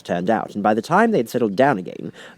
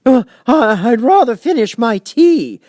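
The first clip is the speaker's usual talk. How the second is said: high, loud, slow quavering plea; trembling Hatter; 10 dB louder, slower than usual. A narrator's reading. Hatter pleads with the King, trembling high and slow. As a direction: beg for mercy, voice shaking.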